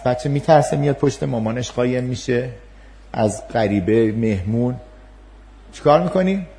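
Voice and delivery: usual speaking voice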